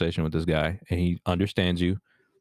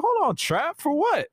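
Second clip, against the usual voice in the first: high squeaky disbelief; a squealing hammed-up what?; mock outrage; slower than usual. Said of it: "I'm be like".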